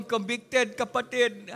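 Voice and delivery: ordinary talk, nothing marked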